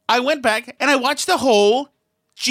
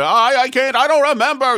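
louder, higher mock Trump stammer; mocking Trump impression; 4 semitones higher, 6 dB louder than usual. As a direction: mimic him fumbling for words. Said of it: "the famous speech where he's going".

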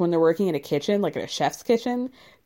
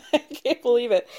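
ordinary delivery

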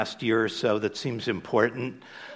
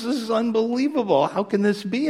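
much higher, astonished exclamation; stunned joke-guy amazement; amazed disbelief; 10 semitones higher than usual. Retold or imitated. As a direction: gasp it out like you can't believe your ears. Man amazed by talking dog.

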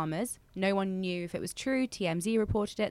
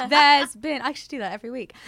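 laughing; 4 semitones higher, 10 dB louder than usual. Real, not acted. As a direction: burst of high loud laughter. Laughs at own sultry intro.

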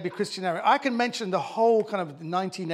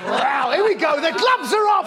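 loud, much higher gleeful cry; excited shout; 10 semitones higher, 9 dB louder than usual. Real, not acted. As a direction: cry out with glee as the fight starts. Mock alarm at a harsh insult.

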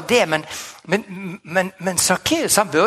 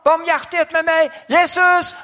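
desperate crying out; 10 semitones higher than usual. Retold or imitated. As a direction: cry out like a blind beggar begging for mercy. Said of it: "Så han skreik og han ropte".

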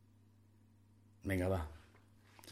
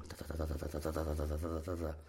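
low, soft rapid ta-ta buzz; tattoo machine noise; 9 semitones lower, 10 dB quieter than usual. Retold or imitated. Teller mimics tattoo gun at work, soft rhythmic tapping. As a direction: mimic the needle going.

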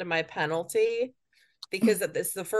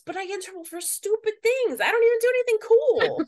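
exasperated whine; 13 semitones higher, 4 dB louder than usual. Real, not acted. Complaining about getting in trouble.

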